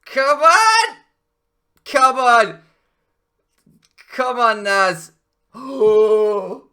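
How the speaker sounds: loud and high, pleading with the game; frustrated shout. Real, not acted. Player shouting at the match going wrong, frustrated yell. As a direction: yell it out at the screen.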